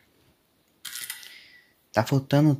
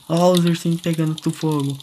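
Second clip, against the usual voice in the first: ordinary delivery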